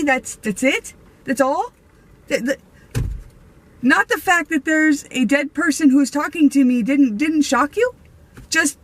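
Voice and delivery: can't believe what you heard, rising; incredulous disbelief. Retold or imitated.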